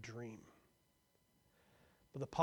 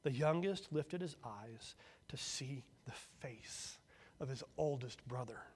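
usual speaking voice

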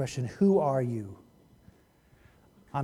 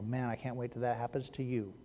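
mock aside to neighbor; 2 semitones lower, 7 dB quieter than usual. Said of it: "look at your neighbor right now and go".

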